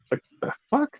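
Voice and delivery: a squeaky, breathless what-the; baffled squeak. Real, not acted. Baffled reaction to denial.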